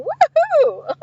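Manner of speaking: high, drawn-out whoop; gleeful celebratory whoop; happy cheer. Real, not acted. Cheering that viewers are still here.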